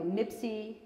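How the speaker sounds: ordinary delivery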